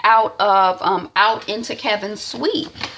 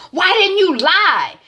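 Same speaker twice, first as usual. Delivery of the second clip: exasperated; 12 semitones higher, 4 dB louder, slower than usual. Retold or imitated. Isaiah scolding Zora.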